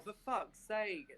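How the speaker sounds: ordinary talk, nothing marked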